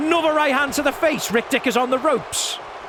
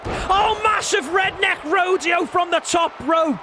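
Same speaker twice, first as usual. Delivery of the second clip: excited call; 6 semitones higher than usual. Acted. Big move called out.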